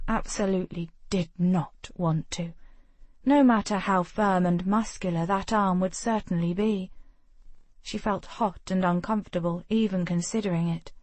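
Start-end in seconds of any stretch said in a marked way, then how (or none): none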